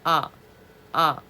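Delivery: 아 with the mouth opened wide. Shown as a demonstration. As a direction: open wide on 아. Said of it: "크게 벌리는 거야". (입을 크게 벌린 아)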